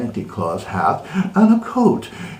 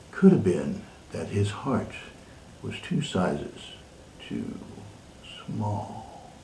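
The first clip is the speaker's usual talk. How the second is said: softer and softer to a near hush; fading to hush; 4 semitones lower, 11 dB quieter than usual. A narrator's reading. Reader dropping to a hush at the tiny heart. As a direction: let it shrink away to nothing. Dramatic reading, shrinking heart.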